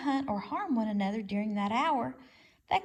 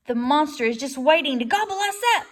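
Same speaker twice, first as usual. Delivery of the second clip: loud, higher frightened shriek; 4 semitones higher, 9 dB louder than usual. A narrator's reading. Groundhog shrieks in panic at the robot, loud. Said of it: "shrieked Dig Down".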